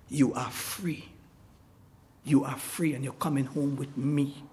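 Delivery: soft and low, gently reassuring; tender welcome. Retold or imitated. Man on the free side welcoming the runaway, soft and tender. Rescuer welcoming escaped slave.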